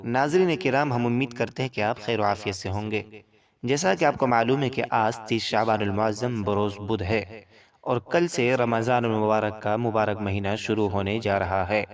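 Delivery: usual speaking voice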